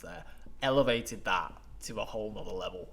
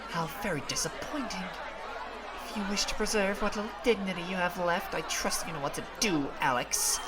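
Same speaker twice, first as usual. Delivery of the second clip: cold threat; 7 semitones higher than usual. Acted. Villain threatens Alex.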